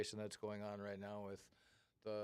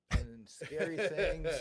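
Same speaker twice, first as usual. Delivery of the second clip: laughing; 6 semitones higher, 12 dB louder, much slower than usual. Real, not acted. Joking about the songs.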